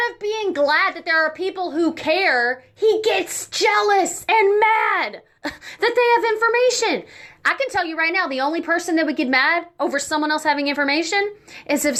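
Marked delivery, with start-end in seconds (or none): 2.7-7.0 laughing. Real, not acted. Laughs mid-rant.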